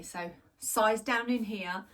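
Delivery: normal talking voice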